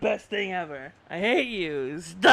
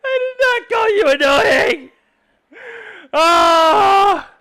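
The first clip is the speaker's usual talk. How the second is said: high, loud scream of denial; shrieking protest; 12 semitones higher, 13 dB louder, much slower than usual. Real, not acted. Streamer screaming denial at chat, shrill shriek. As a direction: scream it back at them.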